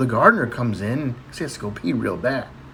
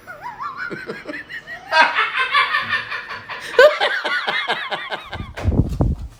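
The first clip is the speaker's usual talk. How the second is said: long high laugh. Real, not acted. Laughs at own punchline.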